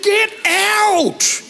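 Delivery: high, incredulous get out; husband stunned that fish came, incredulous. Retold or imitated. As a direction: disbelief at the waiter's mistake. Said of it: "I said".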